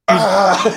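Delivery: high, loud mock cry of panic; excited yelp. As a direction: squeal as your whole life flashes by. Moment of taking the drink.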